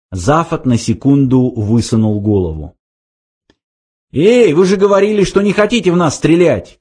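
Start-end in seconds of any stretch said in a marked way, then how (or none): 4.1-6.8 raised-pitch mild yell; calling out. A narrator's reading. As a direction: call across to the attackers in protest. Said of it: "крикнул он".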